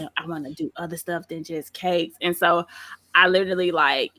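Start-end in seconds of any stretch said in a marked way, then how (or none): 0.0-2.2 very soft, low self-questioning; understated anxious inner voice; retold nervous worry. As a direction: murmur the doubt as if thinking privately. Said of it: "I was nervous".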